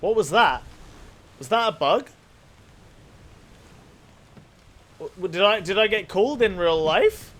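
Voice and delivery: real startled confusion, voice jumps up. Real, not acted. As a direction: caught off guard, asking aloud in alarm. Startled by an unexpected voice.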